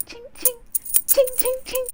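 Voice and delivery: high, light imitated jingle; coins jingling. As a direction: tinkle like falling change. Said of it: "I start hearing ching".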